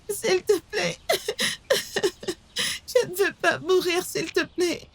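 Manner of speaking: sobbing plea for her life; trembling fear. Acted. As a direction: beg through frightened sobs. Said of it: "C'est pour ça que tu trembles". (supplier en tremblant de peur)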